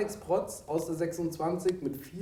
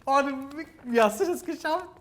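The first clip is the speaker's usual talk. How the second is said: hoch und staunend; ungläubig fragen; erstaunt; 9 semitones higher than usual. Real, not acted. Staunt über richtige Antwort. (völlig verblüfft)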